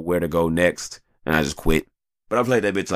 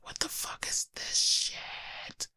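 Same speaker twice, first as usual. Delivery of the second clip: slow stunned whisper; 12 dB quieter, much slower than usual. Retold or imitated. Amazed at dad playing.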